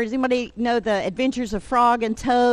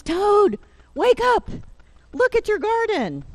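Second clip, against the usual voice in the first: high, loud storybook calling; excited wake-up call; 12 semitones higher, 5 dB louder than usual. Acted. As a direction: call out to rouse a sleeping friend. Frog wakes sleeping Toad.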